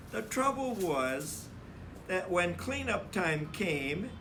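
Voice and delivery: put-on lively read-aloud for children; animated storybook narrator; story narration. A narrator's reading. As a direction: read it like a picture book to a class. Teacher reads picture book to class.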